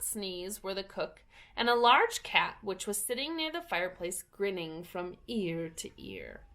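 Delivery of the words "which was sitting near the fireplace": ordinary delivery, nothing marked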